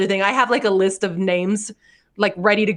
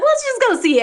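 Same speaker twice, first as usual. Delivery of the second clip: excited. Retold or imitated. Excited adoption event quote.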